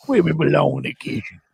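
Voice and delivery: mimicked put-on UK accent. Echoing co-host's reading of the tweet in UK accent. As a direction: copy your friend's silly British accent. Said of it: "that's the U K accent".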